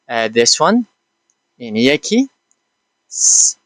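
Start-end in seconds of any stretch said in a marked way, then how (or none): 3.1-3.5 س as a lone, sustained "sss"; sound demonstration. Shown as a demonstration. Teacher demonstrating the س sound on its own, held out. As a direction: hold س as a voiceless hiss, no vowel. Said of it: "صدای س".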